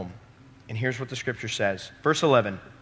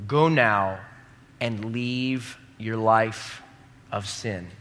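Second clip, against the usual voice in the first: very slow, each word weighed; slow emphatic command; much slower than usual. Shown as a demonstration. Preacher drives Jesus' command home, very slow. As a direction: lay every word down deliberately.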